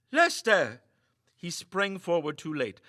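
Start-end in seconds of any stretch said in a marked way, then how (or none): 0.1-0.8 higher, louder alarmed cry of the name; alarmed shout. A narrator's reading. Meagle cries out as Lester slumps over, alarmed shout. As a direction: call out to him in alarm.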